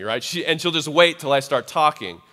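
ordinary delivery

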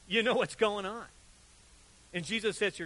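ordinary talk

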